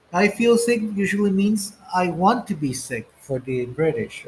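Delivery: ordinary talk, nothing marked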